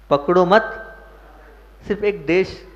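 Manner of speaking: usual speaking voice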